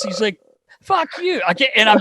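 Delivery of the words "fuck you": much higher mock-grumpy voice; Phil's voice. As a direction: squeak out a grumpy retort. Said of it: "I'm reading it how he talks".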